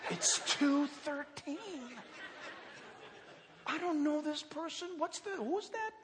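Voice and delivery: baffled night waking. Acted.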